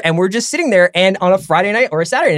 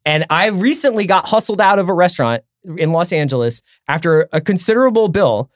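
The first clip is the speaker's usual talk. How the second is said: normal talking voice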